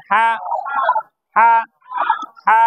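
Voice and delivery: ha pressed out from mid-throat; squeezed ha. Shown as a demonstration. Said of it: "গলার মাঝখান থেকে চেপে বলেন". (গলা চেপে হা বলা)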